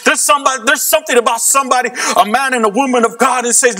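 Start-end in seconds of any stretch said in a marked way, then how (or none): 0.0-2.5 raised, fervent preaching. Acted. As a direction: preach it with rising passion. Preaching at full force.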